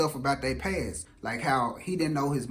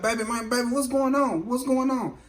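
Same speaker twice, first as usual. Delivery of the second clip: higher-pitched, imitating a panicked boyfriend; acted bewildered pleading; 8 semitones higher than usual. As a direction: plead in mock alarm, pitched up. Shocked man pleads with armed girlfriend.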